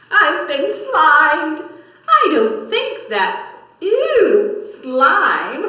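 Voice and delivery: high, loud grossed-out cry; disgusted witch. Acted. Witch slimed by frogs, disgusted. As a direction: recoil at the slime.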